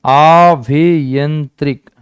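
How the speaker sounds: आभ्यंतरिक drawn out very slowly, syllable by syllable; slow spelled-out word. Shown as a demonstration. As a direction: stretch आभ्यंतरिक out slowly so students hear each sound. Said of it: "बोलो।".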